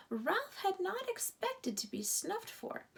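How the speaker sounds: ordinary delivery, nothing marked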